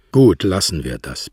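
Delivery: plain reading voice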